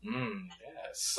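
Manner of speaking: breathy whispered mm, yes; Jeff Goldblum impression. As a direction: imitate Goldblum with a hushed purr. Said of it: "he's like".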